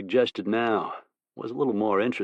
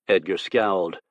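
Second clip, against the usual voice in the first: ordinary delivery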